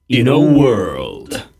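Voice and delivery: movie trailer voice. Shown as a demonstration. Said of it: "do their best trailer voice".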